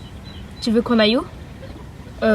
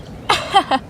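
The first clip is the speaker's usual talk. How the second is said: high gloating villain cackle; short evil cackle; evil laugh; 6 semitones higher than usual. Acted. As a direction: cackle over your prisoner. Villain gloats over captive.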